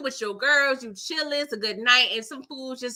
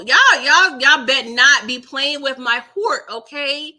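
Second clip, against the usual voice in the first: louder, pleading with the chat; loud plea; 8 dB louder than usual. Real, not acted. Pleading loudly with viewers over the show's cliffhanger. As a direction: beg them not to play with your heart. Begging viewers about the wink.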